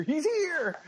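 squeaky high repeat; high-pitched echo. Pretend. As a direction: jump way up high on here. Co-host greeting, echoed back.

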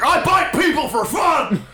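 mock Alex Jones. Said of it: "Alex Jones is like".

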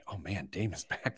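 ordinary talk, nothing marked

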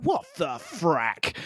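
slow, low, quiet disbelief; understated bewilderment, softly drawn out; baffled reaction. Retold or imitated. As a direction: drag out each word, incredulous. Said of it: "And I'm like".

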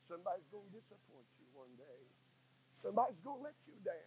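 dropped to a near whisper, pressing close; preacher lowering his voice to warn the congregation; hushed preaching. Acted. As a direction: lean in and murmur the warning. Preacher drops to intimate hush.

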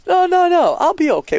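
friend protesting. Retold or imitated. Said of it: "He's going".

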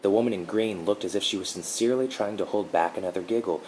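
plain reading voice

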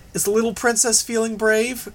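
high mocking sing-song; taunting. A narrator's reading. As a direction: sneer it like a schoolyard bully. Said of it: "Grace taunted Regent".

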